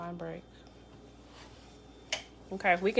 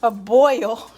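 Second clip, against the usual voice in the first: louder Chicago accent on boil; 4 semitones higher, 7 dB louder than usual. Shown as a demonstration. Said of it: "My Chicago accent".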